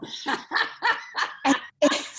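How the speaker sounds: airy unvoiced laugh; breathy laugh. Real, not acted. Laughing at coworker.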